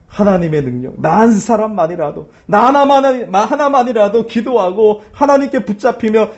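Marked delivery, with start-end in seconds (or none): none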